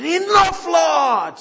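high, loud shout. Retold or imitated. Wigglesworth commanding his healing, a loud shout. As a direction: bellow it out to heaven.

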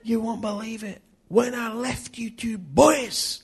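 high-pitched, loud Scottish storyteller; Scottish accent. Acted. Older Scottish man back to tell the preachers, Scottish accent. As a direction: announce it in a big, high Scottish voice. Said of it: "This is my Scottish accent now".

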